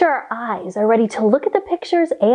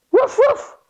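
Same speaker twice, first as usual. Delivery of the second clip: very high, yappy woof woof; puppy woof; 12 semitones higher than usual. Shown as a demonstration. Teacher repeating the dog sound, very high yips. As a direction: yip like a little puppy. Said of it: "give me a big woof woof".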